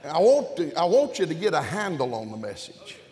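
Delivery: loud, high preacher's call; full-force preaching. Real, not acted. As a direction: drive the message home.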